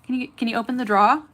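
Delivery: put-on Southern accent. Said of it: "'cause I would say".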